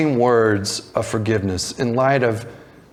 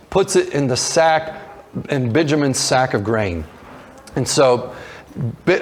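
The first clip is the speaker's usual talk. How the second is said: ordinary talk, nothing marked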